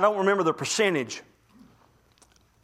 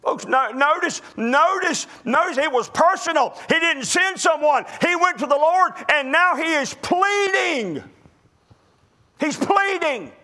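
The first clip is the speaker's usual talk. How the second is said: higher, intense sermon build; rising preaching; 9 semitones higher than usual. Acted. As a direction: drive the point home with rising fervor.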